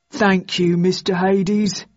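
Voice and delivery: slow, obedient lackey voice; dopey sidekick, grateful and slow; dim-witted henchman. A narrator's reading. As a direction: thank the boss like a dutiful dimwit. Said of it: "matched only by his stupidity".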